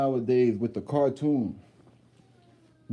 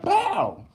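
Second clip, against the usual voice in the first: very high-pitched "pow"; imitated smack sound. Man showing how hard the kid smacked her, imitated impact. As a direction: mimic a hard slap with a high yelp.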